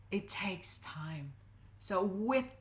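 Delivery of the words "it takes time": slow, hushed and low; hushed emphasis. Real, not acted. Coach explaining how strength gets built, slow and hushed. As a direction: let it sink in, softly and slowly. Coach stressing patience after question.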